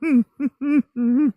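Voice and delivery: high hooting laugh; owl laugh. Real, not acted. Showing viewers his laugh sounds like an owl. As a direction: hoot like an owl. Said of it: "My laugh sounds like an owl".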